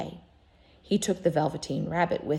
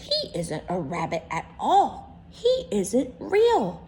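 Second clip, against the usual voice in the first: wild rabbit accusing; 3 semitones higher than usual. Acted. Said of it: "he exclaimed".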